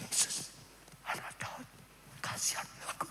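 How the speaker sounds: barely audible polite plea; timid whisper. Shown as a demonstration. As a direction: whisper it so as not to disturb anyone. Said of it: "aku pelan-pelan saja". (berbisik pelan demi kesopanan)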